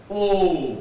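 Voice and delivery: long rounded O; perfect O. Shown as a demonstration. Teacher demonstrating the perfect O, long and round. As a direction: make the mouth absolutely round for O. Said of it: "you produce the perfect O".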